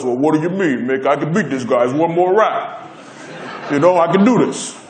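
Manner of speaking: imitated Italian-American boxer voice; light impression of an Italian-American prizefighter; Rocky. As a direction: play a boxer insisting he can still fight. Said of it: "Rocky in his Italian voice".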